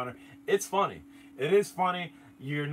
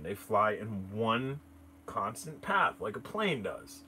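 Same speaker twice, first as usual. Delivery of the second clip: ordinary talk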